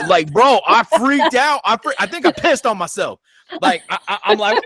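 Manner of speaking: high, keyed-up storytelling; excited. Real, not acted. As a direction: still rattled, talk fast and high. Retelling a fright, pitch stays high.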